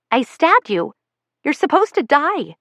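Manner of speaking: put-on high, childish protest; boy whining at his sister; whiny kid brother. A narrator's reading. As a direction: complain like a disappointed nine-year-old. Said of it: "he says".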